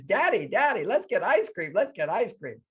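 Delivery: put-on high, sing-song pleading kid; eager mock child's plea; excited little girl. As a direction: imitate a little girl begging Daddy, high and loud. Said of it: "So she's this little girl".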